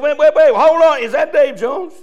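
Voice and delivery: a stranger stopping short in happy disbelief; amazed call-out. Pretend. Stranger in heaven calls out amazed.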